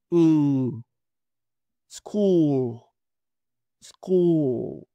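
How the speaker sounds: "School" with lips rounded, long u. Shown as a demonstration. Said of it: "como cuando se va a dar un beso". ("School" con U larga y labios redondeados)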